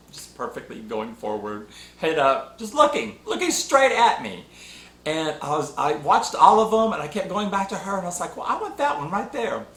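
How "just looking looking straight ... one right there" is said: pitched up, thrilled retelling of choosing her; excited recollection. Retold or imitated. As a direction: let the excitement lift the voice. Excited memory of picking puppy.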